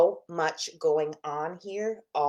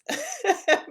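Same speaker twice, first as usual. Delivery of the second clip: high laugh; 5 dB louder than usual. Real, not acted. Laughs at herself.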